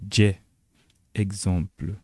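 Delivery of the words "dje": DJE said as "d" plus French "j", then open e; prononciation du C turc. Shown as a demonstration. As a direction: say DJE as one clear Turkish letter name. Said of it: "qui se prononce en turc". (DJE prononcé à la turque, « dj » puis è)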